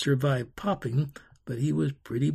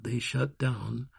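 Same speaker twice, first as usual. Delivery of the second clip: plain reading voice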